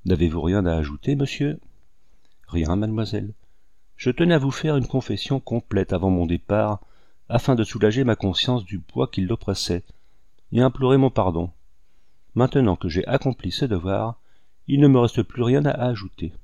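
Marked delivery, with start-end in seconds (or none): none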